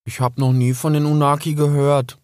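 put-on young fox confessing he never heard of the Unaki; small young fox. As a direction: admit it, feeling small and dumb. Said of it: "fühlte sich dabei furchtbar klein".

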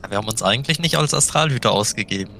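ordinary talk

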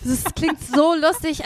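usual speaking voice

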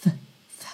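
TH as pure breath, no voice; just blow air for TH; air-only TH. Shown as a demonstration. Teacher isolates the sound in "things", voiceless. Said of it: "Things é só ar".

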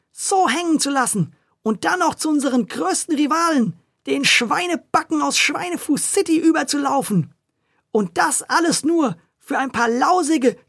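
high, furious tirade; scolding. A narrator's reading. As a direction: tear into him for betrayal. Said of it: "zur Schnecke zu machen".

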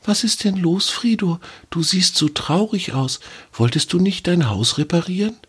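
high, tender little voice, dropping to a whisper; soft, caring question to a sad squirrel; soft and gentle. Acted. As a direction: gently ask the sad friend what's wrong. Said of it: "fragte leise und sanft".

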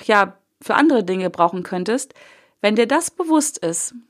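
ordinary delivery

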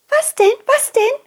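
put-on the curious butterfly girl can't wait to know; excited.

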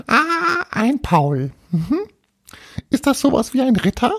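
high, puzzled ghost voice; little castle ghost. Acted. Little ghost questions Paul, high-pitched puzzled voice. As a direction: ask it as a tiny puzzled ghost.

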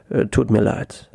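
ordinary delivery, nothing marked

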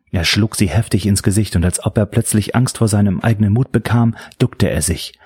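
usual speaking voice

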